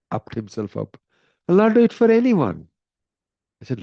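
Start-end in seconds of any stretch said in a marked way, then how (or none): 1.5-2.7 high, loud cry of refusal; indignant refusal. Retold or imitated. King crying out to Mohini, high and indignant. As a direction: shout him down, nearly at a yell.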